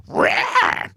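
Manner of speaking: high breathy screech; bird screech. Retold or imitated. Showing the bird's real shriek, high breathy screech. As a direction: screech like an angry pterodactyl. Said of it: "but it's like".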